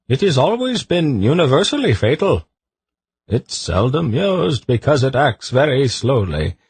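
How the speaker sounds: a high court eunuch delivering fatal news; eunuch Sadi. A narrator's reading. Narrator voices Sadi the eunuch.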